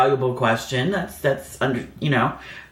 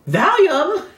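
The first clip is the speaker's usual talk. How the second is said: much higher, louder, disbelieving repeat; incredulous squeak; 14 semitones higher, 5 dB louder than usual. Retold or imitated. To the nurse offering Valium, an incredulous high squeak. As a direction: repeat it like it's absurd.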